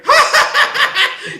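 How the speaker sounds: big high cackle of laughter; loud burst of laughter. Real, not acted. Laughing hard at his own podcast story.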